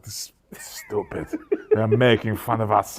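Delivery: slow, badly faked Slovak accent. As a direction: imitate an immigrant father's Slovak accent, slowly. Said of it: "that's not even his accent".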